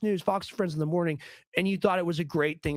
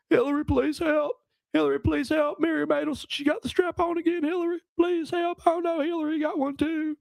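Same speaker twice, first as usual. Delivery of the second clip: higher, throaty pleading with rolled vowels; Bill Clinton impression; 11 semitones higher than usual. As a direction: beg Hillary for help like a panicked Clinton. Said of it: "I got a few good ones".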